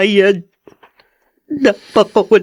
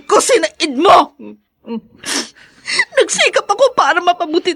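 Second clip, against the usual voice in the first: umiiyak na sumisigaw, mas mataas at mabilis; galit na sigaw; 8 semitones higher than usual. Acted. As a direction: ibinubuhos ang sama ng loob habang humahagulgol. Wife erupts at husband, leaving. (asawang babae, sumisigaw at umiiyak sa galit sa asawang lalaki)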